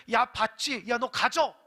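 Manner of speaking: loud, curt mock handover; grudging giving. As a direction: give it as a rebuke, loudly. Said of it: "태도가 다르잖아요".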